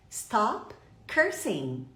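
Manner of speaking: "Stop cursing" at half speed, a gap between words; slow, separated "Stop cursing"; slow teaching model. Shown as a demonstration. Teacher models phrase slowly.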